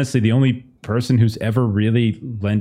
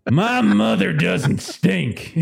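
higher, drawn-out indignant character voice; hammed-up slow protest; indignant retort; 8 semitones higher, much slower than usual. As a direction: stretch it out in a raised, offended voice. Acted in-game retort.

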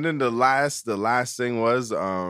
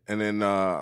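ordinary talk

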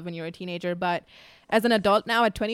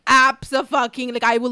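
retold outrage; 3 semitones higher, 8 dB louder than usual. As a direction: refuse the idea flat out. Refusing mom's plan to sell bunnies.

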